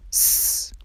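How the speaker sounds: "س" as a bare sustained hiss; voiceless s sound. Shown as a demonstration. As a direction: hiss "س" with no vowel. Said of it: "مثل سین تلفظ کنین". («س» کشیده، مثل سین)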